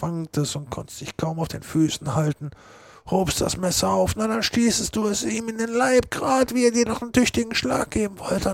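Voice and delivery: calmly feed him the false version; scheming Joe. Acted. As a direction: murderer convincing Potter he did it. Joe narrates the fight falsely.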